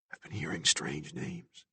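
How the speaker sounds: very soft, slow confiding; hushed. A narrator's reading. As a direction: hush it like a secret. Billy glances around, confides.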